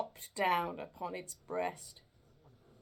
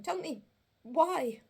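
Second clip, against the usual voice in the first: trembling; 11 semitones higher, 8 dB louder than usual. Acted.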